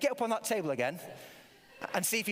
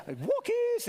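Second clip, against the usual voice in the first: high, sing-song call of walkies to a dog; Barbara Woodhouse; 3 dB louder than usual. Retold or imitated. Imitating the dog trainer calling her dog, high sing-song call. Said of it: "a lady called Barbara Woodhouse".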